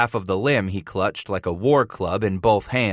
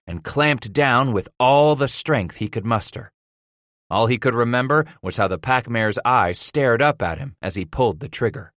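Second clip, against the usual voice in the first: plain reading voice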